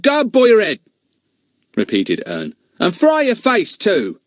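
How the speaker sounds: put-on high boy, slowing on the last part; repeated retort. Ern repeats his rude saying to the children, high and stubborn. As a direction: repeat it stubbornly, dragging the end.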